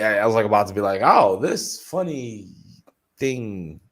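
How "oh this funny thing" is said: slow, higher, louder teasing tease; mock announcement. Hinting at a secret story, a mock teaser voice. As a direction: drag it out like a teaser.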